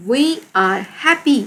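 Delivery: words stretched out for learners; deliberate teacher reading; slow read-aloud. Shown as a demonstration. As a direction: model the sentence slowly. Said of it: "हम खुश हैं".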